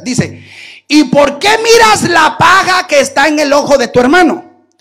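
loud, solemn shouted Bible reading; scripture proclaimed. Acted. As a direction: proclaim the verse to the church.

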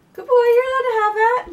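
high, loud puppy praise; high dog praise voice. Real, not acted.

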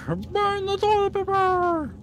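high, slow hammed-up cry; high silly villain voice. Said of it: "repeat in put-on voice".

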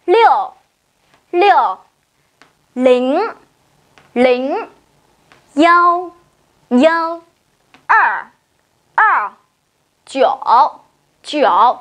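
each Mandarin digit said twice with pauses; repeat after me. Shown as a demonstration. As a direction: model every digit clearly for the class to echo. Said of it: "Đọc cùng cô này".

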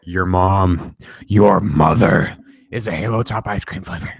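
lightly voiced creepy announcer. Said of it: "that was really creepy".

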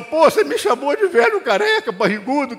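high, hurt wounded protest; hurt complaint. Retold or imitated. Wife's imagined hurt complaint. (reclamação magoada, mais aguda)

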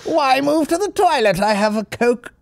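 high, slow and affected luvvie voice; posh luvvie [name]. Retold or imitated. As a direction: camp LA luvvie offering coke. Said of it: "but he's like".

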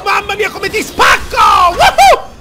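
loud, high gleeful taunts; boastful hype shouting. Real, not acted. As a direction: crow over every hit. Boasting mid-combo.

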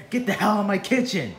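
loud, high angry command; furious order. Acted. Chef Wee Wee ordering Sharky out of his kitchen, furious shout. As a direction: throw him out with your voice.

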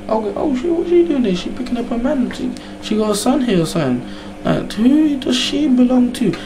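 high puzzled disbelief; a man staring in shock at a woman in his barber; baffled. Retold or imitated. To himself at the barber, high baffled disbelief.

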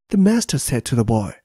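plain reading voice

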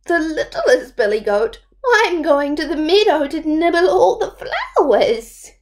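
high, quick little goat voice. Acted. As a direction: chirp it like the smallest goat.

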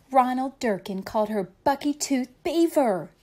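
plain reading voice

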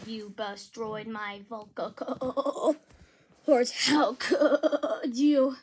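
slow, wavering malfunctioning robot; broken robot voice. Acted. Robot accusing the one who wrecked its vocal cords, glitchy robot voice. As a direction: drag the words out like failing vocal cords. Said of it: "get your robot voice back".

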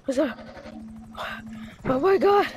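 ordinary talk

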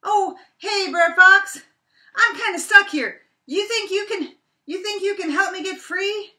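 very high, very loud nervous rabbit voice; sheepish Brer Rabbit. Acted. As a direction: sweet-talk the fox for help, up high. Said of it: "Brer Rabbit says".

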